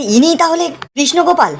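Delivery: mock outcry, pitched high without much volume; high-pitched, half-hearted exclamation; retold cry out. Piu at the wedding on seeing Krishnagopal, retold cry out. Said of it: "চিৎকার করে বলছে".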